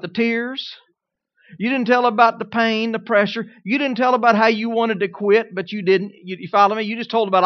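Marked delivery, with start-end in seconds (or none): none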